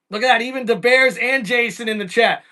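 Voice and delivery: ordinary talk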